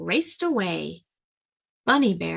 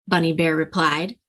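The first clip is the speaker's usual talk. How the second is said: ordinary delivery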